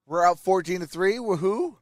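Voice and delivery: ordinary delivery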